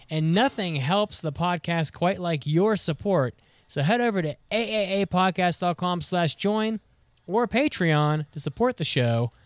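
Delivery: upbeat commercial read. Acted. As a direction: pitch it like a radio spot. Podcast ad read.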